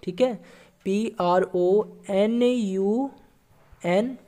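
very slow letters with long pauses; spelling out slowly. Shown as a demonstration. Teacher spelling a long word for students, very slow. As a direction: dictate each letter so they can follow. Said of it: "pronunciation को कैसे लिखिएगा आप लोग?".

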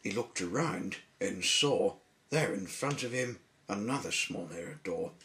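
ordinary delivery

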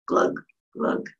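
breathy whispered sinking glug. Acted. Whispering the frog's glug to the kids. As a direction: whisper the frog going under. Frog sinks in pool.

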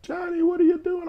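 very high, loud mock-wailing mother; crying Italian mother. Italian mother hears son fled to Vegas, mock crying. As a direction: imitate a distraught mom, shooting way up high. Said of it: "this Italian mother who's, like, crying".

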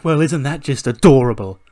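loud, scornful sneer; mock him with exaggerated sweetness; loud sarcastic put-down; sarcastic mockery. Roode mocks Gulak, acted.

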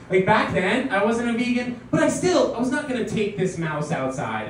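ordinary talk, nothing marked

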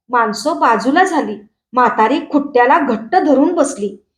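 ordinary delivery

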